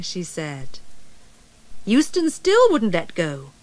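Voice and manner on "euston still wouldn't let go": usual speaking voice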